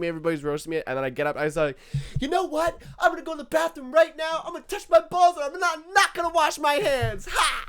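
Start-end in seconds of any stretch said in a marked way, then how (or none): 2.2-7.6 high-pitched, shouted mock threat; re-enacted triumphant tantrum; defiant outburst. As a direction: yell it out in comic defiance.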